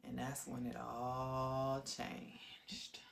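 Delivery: very slow, low and half-whispered; hushed, grave. Real, not acted. Storyteller marks the turning point of her life, hushed. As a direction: stretch it out, dropping to a whisper.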